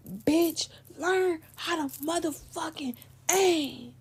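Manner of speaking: high, drawn-out indignant imitation; mock outraged boyfriend, stretched out=; retold scolding. Boyfriend scolds friend who missed.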